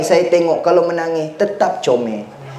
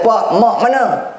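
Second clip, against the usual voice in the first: put-on child's voice; 6 semitones higher than usual. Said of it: "sekali anak kata".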